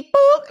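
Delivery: sharp high bleep sound over a cuss word; imitated censor bleep. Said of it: "there was a cuss word".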